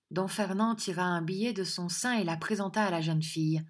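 ordinary delivery, nothing marked

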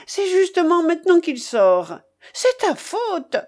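high-pitched, indignant complaint; accusing outburst. Acted. Babouchka blames her companion for losing, accusing. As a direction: wail the blame at him.

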